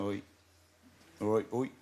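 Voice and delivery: a light Devon "oi"; a quick Devon greeting; a hint of a Devon accent. Shown as a demonstration. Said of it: "Could you do some of the accent".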